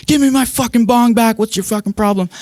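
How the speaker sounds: high-pitched mock rant at the boyfriend; hammed-up girlfriend demanding her bong; mocking angry girlfriend. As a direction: squeal it like a furious girlfriend.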